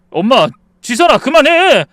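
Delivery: loud, high helpless yell; shouting to stop. Acted. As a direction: call both names, then scream to stop. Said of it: "하고 소리만 질렀죠".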